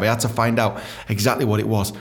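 ordinary delivery

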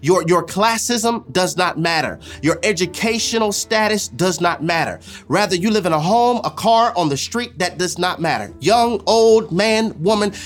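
ordinary talk

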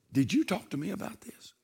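soft, unvoiced whisper of the Spirit; quiet whispered question from the Holy Spirit; gentle whisper. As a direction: breathe it like a still small voice. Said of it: "the Holy Spirit will whisper".

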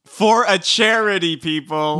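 loud, high, drawn-out CHARITY; emphatic exclamation. Real, not acted. Co-host exclaiming over the speech for charity, emphatic.

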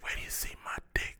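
breathy mock come-on whisper; creepy ear whisper. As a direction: whisper it right into her ear. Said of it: "Could you imagine going up to somebody and say".

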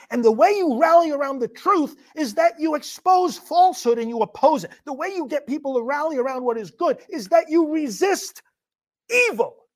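high, loud, impassioned exhortation; rally them around the truth; full-force preaching. Real, not acted.

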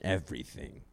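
low, hushed mock-regal father; solemnly bequeath a kingdom to your son; deep voice. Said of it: "I keep a deep voice the whole time".

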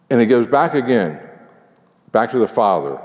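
ordinary delivery

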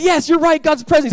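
high, loud forced agreement; hammed-up parent salvaging the answer; overeager spin. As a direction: seize on it with exaggerated enthusiasm. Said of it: "trying to, like, spin it".

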